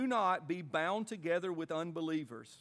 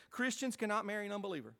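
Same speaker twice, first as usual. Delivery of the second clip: fast emphatic statement; faster than usual. Acted. Preacher laying down the rule to the congregation, fast and emphatic. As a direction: rattle off "Christians cannot marry an unbeliever" quickly with weight.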